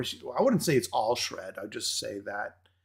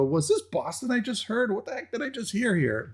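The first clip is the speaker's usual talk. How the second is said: high-pitched retold bafflement; puzzled surprise; 9 semitones higher than usual. Host recalling first hearing the song, puzzled surprise. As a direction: act out a startled double take.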